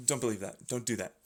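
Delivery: ordinary delivery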